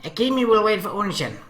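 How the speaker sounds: put-on high, childish little girl; little sister voice. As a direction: chirp it like a small girl clinging to her brother. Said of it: "the little one said".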